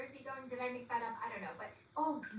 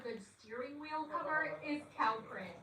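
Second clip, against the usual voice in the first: ordinary talk, nothing marked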